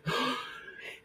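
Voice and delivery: sharp excited intake of breath; big breathy gasp; stunned gasp. Retold or imitated. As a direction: gasp in delighted shock. Reacting to Sony interview offer.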